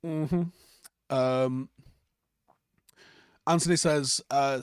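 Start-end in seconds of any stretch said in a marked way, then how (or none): none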